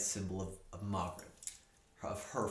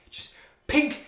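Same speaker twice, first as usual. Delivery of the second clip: gleeful dwarf; 10 dB louder than usual. Acted. Dwarf laughs off the snake bite.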